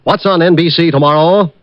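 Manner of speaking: ordinary talk, nothing marked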